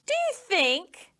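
high, slow sly serpent; slow, sly snake asking. A narrator's reading. Snake voice in story.

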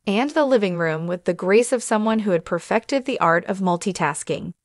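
plain reading voice

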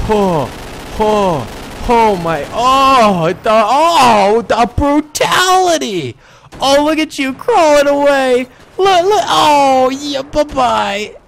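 loud, high, rising exclamations; exhilarated gloating. Real, not acted. Gunner watching enemies crawl away, gleeful shouting. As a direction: build from gasped ohs to high drawn-out taunts. Crowing over a massacre.

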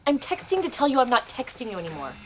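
normal talking voice

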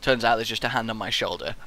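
normal talking voice

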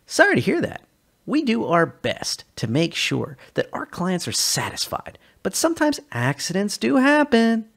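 customer service act. Acted.

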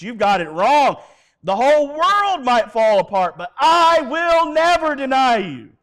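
loud, high shout of loyalty; vehement protest. Retold or imitated. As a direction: shout an impassioned vow as Peter. Peter protests his loyalty.

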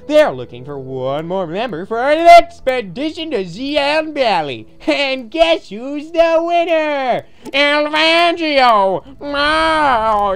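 loud, drawn-out contest announcer; grand game-show proclamation; mock announcer. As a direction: build up to the winner's name, high and slow. Mock contest announcer names winner.

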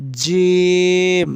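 জিম stretched very slowly, jim said like a voiced j; জিম, টেনে টেনে; drawn-out jim. Shown as a demonstration. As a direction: hold জিম long with a voiced j. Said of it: "জিম হবে বর্গীয় জর মতো".

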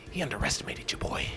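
whisper. Shown as a demonstration. Gamer whispering a boast about his win. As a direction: whisper it like a secret aside. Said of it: "repeated as whisper".

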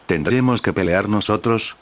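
plain reading voice

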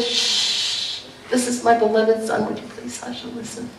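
hushing. Retold or imitated. The Father hushes Peter.

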